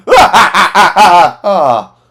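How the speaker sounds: loud, high mocking laugh; gleeful laughing. Reviewer imitating Cavill's loud gleeful laugh. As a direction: cackle loudly while mowing them down. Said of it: "He's like".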